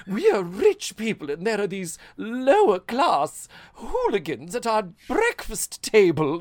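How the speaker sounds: high, loud upper-class outrage; hammed-up rich person's indignation; snooty rich person. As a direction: declaim it as a haughty snob. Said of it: "all of that class shit".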